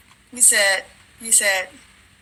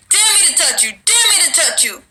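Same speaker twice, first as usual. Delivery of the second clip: higher, needling demon taunt; a lightly voiced demon mocking; 6 semitones higher than usual. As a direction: goad him to dare you. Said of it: "He said".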